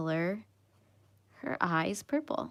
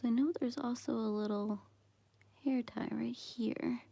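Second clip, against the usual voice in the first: whispering; 2 semitones higher, 14 dB quieter than usual. Real, not acted. Whispered coloring stream chat.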